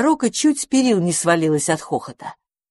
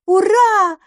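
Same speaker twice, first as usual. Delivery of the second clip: very high, loud little shout; loudest cheer; 6 dB louder than usual. A narrator's reading. Little Dandelion jumps for joy, loudest high shout. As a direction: cheer louder than everyone. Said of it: "громче всех закричал маленький одуванчик".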